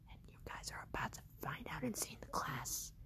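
hushed, low storyteller teaser; suspenseful whisper. Acted. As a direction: whisper it to draw listeners in.

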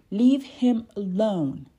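firm, loud and slow demand. A narrator's reading. Coco ordering the bullies off Pedro, loud and firm. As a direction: plant your feet and demand it. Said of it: "Coco demanded".

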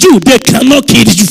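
thunderous preacher's shout; very loud, hammered-out promise; full-force declaration. Real, not acted. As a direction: proclaim it at the top of your voice to draw an amen. Repeats louder after weak amen.

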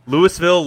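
Louisville said LOO-iss-vill; quiz pronunciation option. Shown as a demonstration. Host repeats his question, Louisville as LOO-iss-vill. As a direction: sound the S in Louisville. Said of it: "How do you pronounce".

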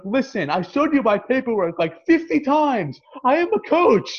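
retold argument, hammering out the last words; indignant protest. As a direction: protest to an umpire with rising exasperation.

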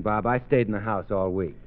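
normal talking voice

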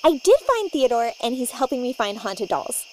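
normal talking voice

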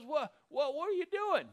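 a startled priest crying out; panicked high-pitched what; shocked squeak. Retold or imitated. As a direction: voice jumps high in alarm. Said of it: "He goes".

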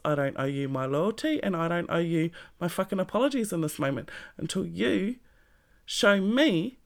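normal talking voice

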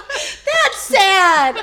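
high, loud laughing protest; amused wail, high and loud; laughing dismay. Real, not acted. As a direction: cry it out through laughter. Laughing at being teased.

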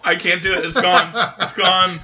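ordinary talk

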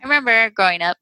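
ordinary talk, nothing marked

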